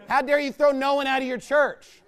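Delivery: normal talking voice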